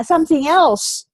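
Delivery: normal talking voice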